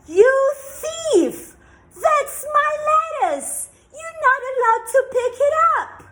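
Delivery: shrieking furious witch; angry witch shout. Acted. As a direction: scream at the thief, high and loud. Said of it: "she said".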